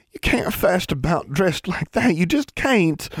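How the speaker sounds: put-on high, slow girl with Ozark accent; thick Ozark accent. A narrator's reading. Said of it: "her Ozark accent emerged more thickly".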